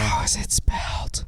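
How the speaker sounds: whispered question. Real, not acted. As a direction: ask it under your breath. Joking hushed aside.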